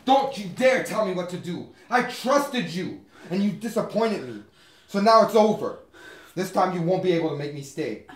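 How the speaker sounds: high, forceful, heated declaration; angry defiance. Acted. Man telling Carol it's over, angry and defiant. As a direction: refuse angrily, leaning hard on each phrase.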